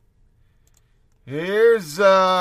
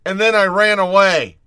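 higher, louder sneering brag; mocking cocky player; 6 semitones higher, 5 dB louder than usual. Host mocking the player who kicked, sneering brag. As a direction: mimic a smug player gloating over a cheap shot.

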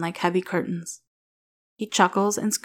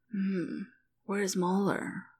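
slow, soft, exhausted mumble; mumbling; 5 dB quieter, much slower than usual. A narrator's reading. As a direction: mumble it, barely awake and numb. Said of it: "she mumbles".

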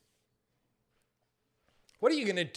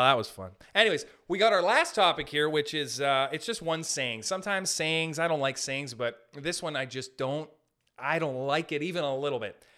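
ordinary talk

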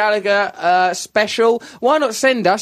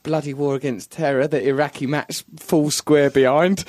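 ordinary talk, nothing marked

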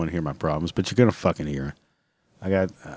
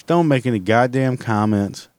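ordinary talk, nothing marked

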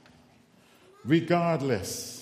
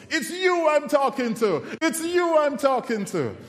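high-pitched, louder imitation of a caller; insistent calling out; 12 semitones higher, 4 dB louder than usual. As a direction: mimic someone insistently hailing a passer-by, pitched high. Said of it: "I heard this voice".

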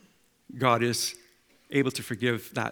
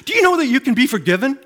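loud, much higher, fast and pressing; eager fast question; 11 semitones higher, 9 dB louder, faster than usual. Retold or imitated. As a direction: rush the question with zeal. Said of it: "He says".

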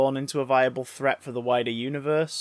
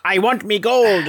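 louder Irish leprechaun voice, a bit slow; Irish leprechaun accent done lightly; 5 semitones higher, 5 dB louder than usual. Retold or imitated. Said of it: "is what Warwick said".

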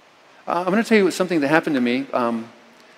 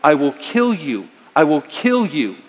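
retold threat. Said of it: "I said something like".

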